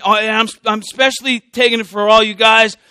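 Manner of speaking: ordinary talk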